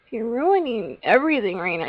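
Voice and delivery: whiny mock complaint with swooping pitch; theatrical exasperated moan; playful exasperation. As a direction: complain dramatically, voice rising and falling. Mock scolding at a creeper.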